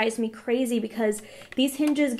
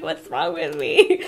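slow, laughing through the words, louder; laughing self-quote; 4 semitones higher, 4 dB louder, slower than usual. Retold or imitated. To viewers about feeling sick, laughing. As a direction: laugh at her own question. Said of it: "I'm like".

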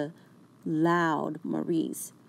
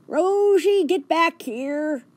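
loud high call, then slow; 10 semitones higher, 6 dB louder, slower than usual. A narrator's reading. George calls Rosie back from the squirrels, loud. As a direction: shout her back across the park.

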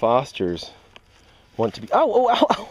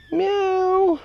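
high-pitched cat meow; squeaky meow calling kittens. Real, not acted. Imitating a cat.

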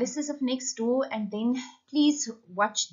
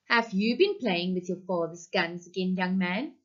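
ordinary delivery, nothing marked

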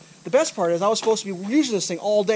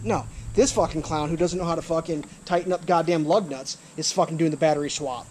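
normal talking voice

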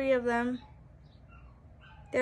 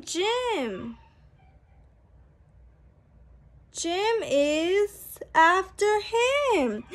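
bright, sing-song picture-book reading; reading to children; 5 semitones higher, 5 dB louder, much slower than usual. A narrator's reading. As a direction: read it out like a storybook for little kids. Book text read aloud.

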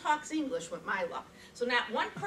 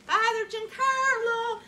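high, loud, drawn-out call across the church; yelling out; 10 semitones higher, 6 dB louder, slower than usual. Retold or imitated. Searching a crowded church for the priest, calling out loud. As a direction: call the name out long to find him in the crowd. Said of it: "I yell out".

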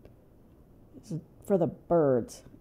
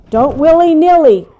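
urgent self-scolding; 7 semitones higher, 13 dB louder than usual. Real, not acted.

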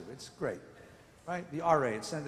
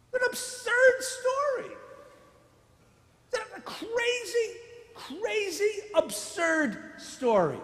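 absurd story; 13 semitones higher, 4 dB louder, slower than usual. Real, not acted.

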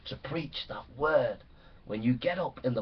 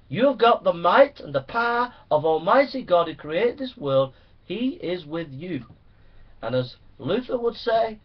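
rising, louder, fervent preaching; preaching at full force; 6 semitones higher, 7 dB louder than usual. Acted. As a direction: pound it out like a revival preacher.

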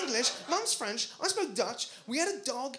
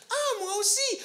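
delighted fundraiser; 13 semitones higher than usual. Retold or imitated. Fundraiser replies in French, higher and delighted. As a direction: chirp it back in triumph. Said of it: "this guy comes up to me and goes".

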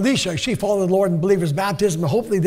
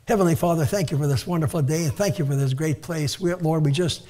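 lower, softer praying voice; prayer; 5 semitones lower, 4 dB quieter than usual. Real, not acted. Preacher thanks God for the day, quiet prayer. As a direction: address God quietly and reverently.

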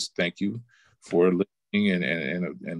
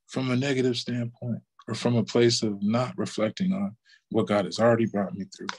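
ordinary talk, nothing marked

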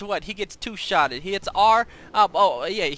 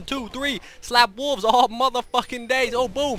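ordinary talk

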